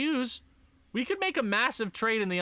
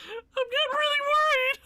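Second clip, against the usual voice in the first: mock anguished wail; 4 dB louder than usual. Real, not acted. Team slumping.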